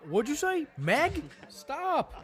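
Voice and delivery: high, urgent call to the screen. Pretend. Calling out to character.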